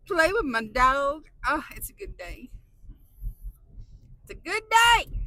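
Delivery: loud, joyful shout; happy yell. Real, not acted. Vlogger happily shouting about her day, loud joyful shout. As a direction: shout it out with glee. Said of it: "didn't mean to yell".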